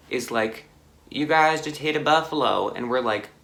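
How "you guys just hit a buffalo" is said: lightly voiced bystander, higher and louder; retold motorcycle guy. Motorcycle guy telling the crashed friends, lightly imitated. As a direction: imitate the biker's announcement, raised in pitch and volume. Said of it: "this, the motorcycle guy".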